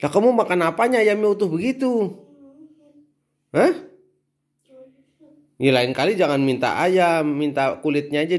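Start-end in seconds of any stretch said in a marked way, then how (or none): 0.0-3.9 much higher, incredulous; surprised. Real, not acted. Parent shocked child ate the whole chicken, high incredulous. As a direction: caught off guard that the whole chicken is gone.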